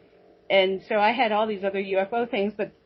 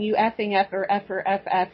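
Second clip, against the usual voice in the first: ordinary delivery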